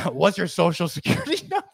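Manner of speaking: smiling salesman. Retold or imitated. Salesman asking a man with no number, smiling and laughing. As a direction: ask it with a grin, laughing through it. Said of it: "he smiles. He goes".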